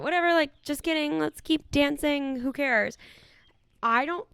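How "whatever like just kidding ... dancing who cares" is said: mock casual brush-off. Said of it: "I just think you're like".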